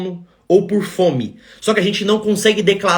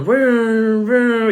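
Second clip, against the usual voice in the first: drawn-out passing-truck engine noise; imitated truck vroom; 4 semitones higher than usual. Doctor hears trucks passing over the phone, mimicked vroom. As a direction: mimic trucks whooshing past. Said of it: "escutando os caminhões passando".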